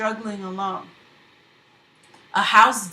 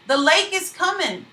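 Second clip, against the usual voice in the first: high, loud alarm cry; 8 semitones higher, 5 dB louder than usual. Acted. Fleeing crowd crying warning of the lake, loud alarm. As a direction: shout the warning as you run. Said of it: "crying the same as they went".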